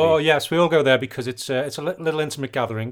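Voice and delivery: lightly put-on posh English voice; a hint of upper-class British; mock posh British accent. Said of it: "I lost my, my posh accent".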